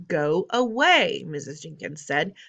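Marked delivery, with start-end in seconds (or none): 0.1-1.2 slow, loud and higher; contemptuous dismissal. Acted. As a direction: drag it out with disdain. Mrs Jenkins dismisses grandmother.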